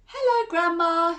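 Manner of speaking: high, loud call through the door. Acted. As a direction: call out brightly to grandma. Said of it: "she called".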